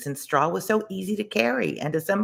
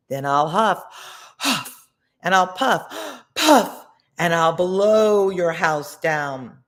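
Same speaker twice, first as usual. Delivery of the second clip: louder huffing threat, puff rising high, slow ending; wolf growl; 4 semitones higher, 6 dB louder, slower than usual. A narrator's reading. The wolf threatens the stick house pig, loud and slow. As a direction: build the threat, puffing up. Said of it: "the wolf growled".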